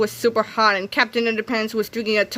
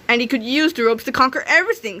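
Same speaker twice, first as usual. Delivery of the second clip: plain reading voice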